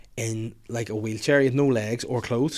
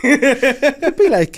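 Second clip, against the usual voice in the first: creepy host voice; 12 semitones higher, 7 dB louder, much slower than usual. Pretend. Playing the pedophile club host.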